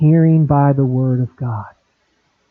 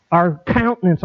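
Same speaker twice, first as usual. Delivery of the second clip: usual speaking voice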